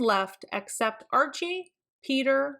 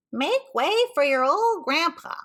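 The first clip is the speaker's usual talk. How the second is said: put-on shaky old grandpa; 7 semitones higher, 4 dB louder than usual. Archie pretends to be grandpa for Peter, quavery. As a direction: kid putting on a quavery old man voice. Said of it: "in a shaky voice".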